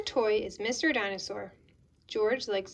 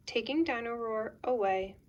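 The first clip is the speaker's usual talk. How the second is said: plain reading voice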